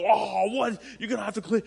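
loud, high mock outburst; mock exasperation. Preacher acting the normal reaction to a spill, loud mock exasperation. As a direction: act out an exasperated cry over a spill. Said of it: "where you would normally go".

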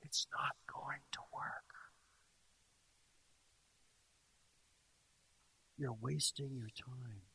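slow, voiceless whisper into the ear; devil's whisper. Acted. Devil discouraging someone who has long been praying, whispered. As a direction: whisper it slowly to sow doubt. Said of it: "he's gonna whisper into your ear".

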